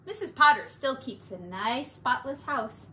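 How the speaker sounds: usual speaking voice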